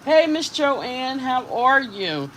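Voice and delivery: high, slow, sing-song hello; chirpy mock greeting; cheery greeting voice. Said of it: "my throat just seems like it went".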